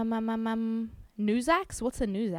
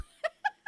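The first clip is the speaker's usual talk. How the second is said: high laugh. Real, not acted. Reacting to chat mishap.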